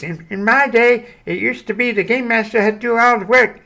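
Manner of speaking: put-on high, toothless old geezer voice; old man voice. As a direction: gum it out like a toothless old-timer. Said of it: "gonna take my teeth out and do the old vo-, old man voice".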